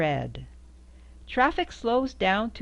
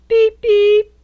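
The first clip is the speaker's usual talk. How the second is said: much higher, loud tinny beeps; high squeaky beep; 15 dB louder than usual. Acted. Reading to a child, beeping high and loud like a horn.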